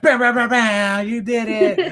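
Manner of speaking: slow, loud, high hype chant; hype shout. Acted. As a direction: draw out each bam loudly. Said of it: "it's gotta be special".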